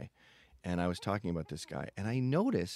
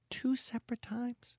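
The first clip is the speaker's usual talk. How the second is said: whisper. Real, not acted.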